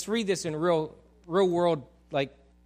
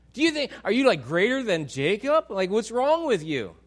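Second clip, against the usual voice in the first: scornful sarcasm; 4 semitones higher, 6 dB louder than usual. Retold or imitated. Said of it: "kinda throwing back sarcasm".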